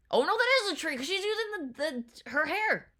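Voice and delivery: loud, high-pitched burst of discovery; excited realization. Real, not acted. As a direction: blurt it out as it suddenly clicks. Sudden realization it is a tree.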